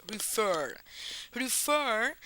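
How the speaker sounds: "refer" with the second syllable stressed; stress on last syllable. Shown as a demonstration. English teacher showing where refer is accented, stress on the final syllable. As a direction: lean on the end of "refer". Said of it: "está acentuada, o sea, tiene una sílaba tónica".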